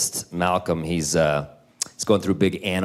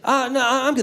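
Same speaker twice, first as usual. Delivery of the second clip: put-on high little-kid voice; three-year-old; 14 semitones higher than usual. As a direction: imitate a small child backing out. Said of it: "this three-year-old's like".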